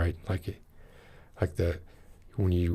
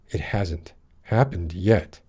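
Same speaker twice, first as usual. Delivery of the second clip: slow, "happened" stressed with a rising end; "happened" carries the line, slowly; slower than usual. Shown as a demonstration. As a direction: draw it out and hit "happened". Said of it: "you're stressing the".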